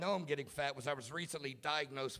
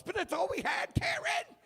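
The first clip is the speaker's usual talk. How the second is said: shrieked very high and loud; frantic screeching wife; panicked wife screaming at the flushing toilet; Goodfellas Karen; 6 dB louder than usual. Retold or imitated. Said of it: "I gotta run in like Karen on Goodfellas".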